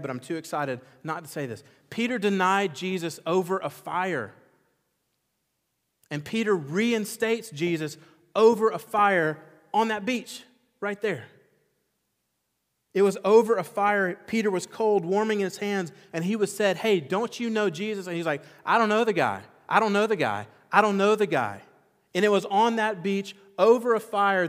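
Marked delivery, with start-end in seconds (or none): 1.9-18.4 higher and louder, impassioned sermon climax; fiery preacher telling of Peter at the fire; preaching at full force. Acted. 18.6-21.6 higher and louder, a panicked repeated denial; loud frantic denial. Retold or imitated. Peter denying Jesus at the fire. 22.1-24.5 higher and louder, impassioned sermon climax; fiery preacher telling of Peter at the fire; preaching at full force. Acted.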